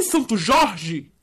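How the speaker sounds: usual speaking voice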